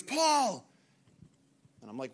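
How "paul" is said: high, full-volume yell; calling from afar. Retold or imitated. Finnish teammate yelling for his guide across the forest, urgent distant shout. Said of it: "I hear all the way from over there".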